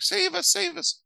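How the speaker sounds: very high squeaky cry for help; a tiny pup begging to be saved; squeaky puppy plea. Retold or imitated. Trapped puppies under the net begging for rescue, in a very high squeaky voice. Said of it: "the buddies are all barking, like".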